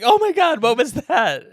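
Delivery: high, startled mock outcry; mock shocked. Retelling the rib-cracking CPR scene, mock shocked.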